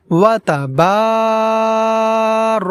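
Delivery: long drawn-out ওয়া তাবার, vowel held; drawn-out Quranic recitation; elongated vowel. Shown as a demonstration. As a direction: stretch the আ in তাবার slowly. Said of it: "বলতে হবে এখানে আমাদেরকে".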